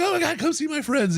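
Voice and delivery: high, thrilled drunk-fan imitation; tanked fan. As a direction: play the overjoyed drunk eager to show off. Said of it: "he's tanked".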